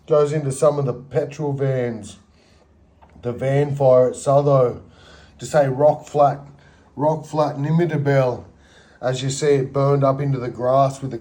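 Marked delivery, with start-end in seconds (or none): none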